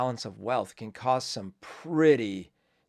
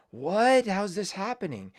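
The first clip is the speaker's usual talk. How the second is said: mock surprise; 7 semitones higher than usual. Vlogger mocks people pretending to be shocked, high exclamation. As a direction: act shocked, hammed-up. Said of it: "we can't act surprised and like".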